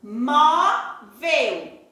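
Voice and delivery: "Móvel" drawn out in two parts, mó-vel; syllable by syllable. Shown as a demonstration. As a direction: sound out "Móvel" slowly, holding each syllable. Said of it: "eu tenho que pronunciar pra saber". ("Móvel" esticado, separando "mó" e "vel")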